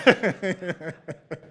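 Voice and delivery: loud, high burst of laughter; real laugh. Real, not acted. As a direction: let a big laugh out. Audience joke lands.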